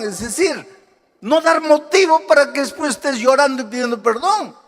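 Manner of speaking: high, driving preacher delivery; impassioned preaching. Real, not acted. Preacher warning congregation about outbursts and apologies, impassioned.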